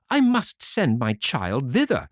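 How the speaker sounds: high, sugary persuading voice; mother urging Fanny to the fountain; coaxing mother. Acted. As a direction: sweet-talk the spoiled daughter. Mother coaxes her favourite daughter.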